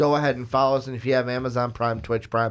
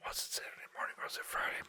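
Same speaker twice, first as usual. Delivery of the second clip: fast, hushed muttering to himself; whispered aside; 19 dB quieter, much faster than usual. Real, not acted. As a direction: whisper it like thinking aloud.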